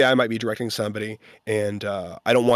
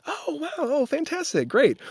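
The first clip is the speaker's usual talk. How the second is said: outwardly composed. Retold or imitated. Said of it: "outwardly, I'm like".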